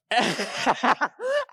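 high burst of laughter; real laugh. Real, not acted. Host recalls the boy's fall, real high laugh. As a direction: crack up helplessly. Cracks up before telling the fall.

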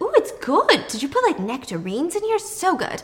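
high, swooping delight; pleased. Acted. Noah sips the drink Steve made, delighted.

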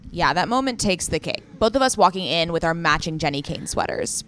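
loud, scripted co-host banter; ad read. Real, not acted. Co-host agreeing about matching sweaters, loud ad read. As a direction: chime in emphatically, selling the brand.

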